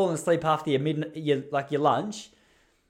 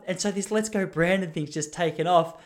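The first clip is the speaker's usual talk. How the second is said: normal talking voice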